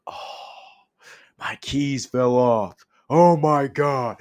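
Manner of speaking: loud, drawn-out wail of fake despair; mock meltdown. Man mocking overreaction to fallen keys, hammed-up anguished outburst. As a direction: wail it out, hammed-up, as if disaster struck.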